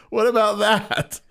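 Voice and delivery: high, loud, slow outraged query; indignant question. Retold or imitated. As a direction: protest the double standard. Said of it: "I'm like".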